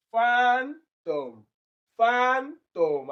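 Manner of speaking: "Phantom" with FAN high and held, TOM dropping; stress on first syllable. Shown as a demonstration. Teacher demonstrates first syllable stress, high and drawn out. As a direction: punch FAN in "Phantom", then fall away. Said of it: "It is the first syllable".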